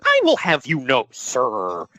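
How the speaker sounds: mock outrage. Host theatrically defending the good name of Paul E., mock-indignant. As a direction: defend his honor with pompous mock offense.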